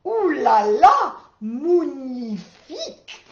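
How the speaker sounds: loud, thrilled Ooh la la, slow drawn-out magnifique; delighted French cry. Acted. The cat pounces on the bone, loud delighted French cry. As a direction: crow it out in French pleasure. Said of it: "and then she pounced".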